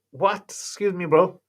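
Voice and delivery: high-pitched mock disbelief; retold puzzled reaction, voice jumping up; acted bafflement. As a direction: play taken aback by the friend's odd word. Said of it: "I go".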